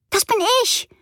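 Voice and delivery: very high, joyful squeal; excited girl. A narrator's reading. Frieda hears the parcel is for her, squealing high. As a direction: burst out with delight.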